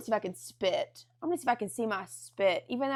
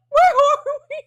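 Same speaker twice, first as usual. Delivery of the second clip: high, loud mock panic; hammed-up high-pitched bewilderment; bewildered shriek; 11 dB louder than usual. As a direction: cry out in startled confusion. Woken up confused, acted out.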